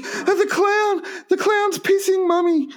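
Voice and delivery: put-on very high, wailing child voice; frightened little child. Scarred girl telling her mum about nightmares, mock tearful child voice. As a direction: whimper it to Mummy, hammed-up. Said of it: "Her mum's saying".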